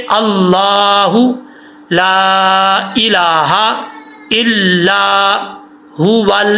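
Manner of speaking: slow, drawn-out chant of the verse; slow recitation. Shown as a demonstration. Teacher reciting the verse again to students, slow chant. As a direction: recite it slowly and evenly, line by line.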